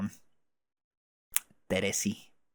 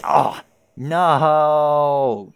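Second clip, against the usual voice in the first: high, loud, drawn-out cry; full-out dramatic shout; long wailed no; 4 semitones higher, 10 dB louder than usual. Acted. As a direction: cry out and hold the vowel. Acted scene, drawn-out cry of no.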